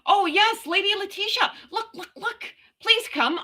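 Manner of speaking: high-pitched eager pleading; giddy, high squeal of invitation; excited calling. Real, not acted. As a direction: call a friend over in a high, excited voice. Eagerly calls a friend to join.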